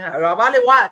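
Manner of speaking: loud, playful Acadian accent; accent acadien. Shown as a demonstration. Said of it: "Je sais que vous aimez mon accent". (accent acadien lancé bien fort)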